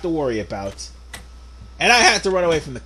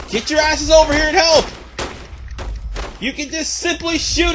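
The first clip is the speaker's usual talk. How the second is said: frantic screaming for a medic; high furious yelling at useless teammate; man down shout; 10 semitones higher, 3 dB louder than usual. Real, not acted.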